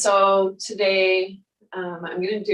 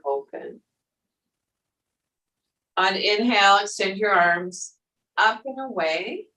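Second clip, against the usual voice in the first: guided meditation. Acted. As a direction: guide them gently through the breath. Yoga teacher guiding breath and movement.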